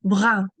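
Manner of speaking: "Brun" loud, with a silent n and a nasal French vowel; French pronunciation of brun. Shown as a demonstration. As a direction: say "Brun" as a clear French teaching model. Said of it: "let's practice pronouncing this".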